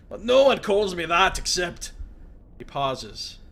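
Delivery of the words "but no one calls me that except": loud, raised voice of a wounded prisoner; gruff Mazov. Acted. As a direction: bark it at the intruder in surprise.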